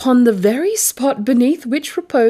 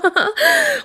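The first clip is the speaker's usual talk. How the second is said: gleeful mad laugh; 14 semitones higher than usual. Acted. Madman gloating over crime.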